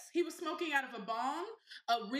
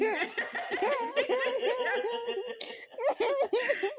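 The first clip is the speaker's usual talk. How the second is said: much higher shrieking laughter; high cackling laugh; 11 semitones higher, 6 dB louder than usual. Real, not acted. Cracking up over press-ons.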